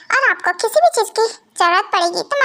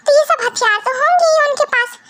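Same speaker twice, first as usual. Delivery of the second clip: mocking taunt; 7 semitones higher than usual. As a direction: needle the mafia husband. Wife mocks mafia husband's weapons. (ताना मारते हुए)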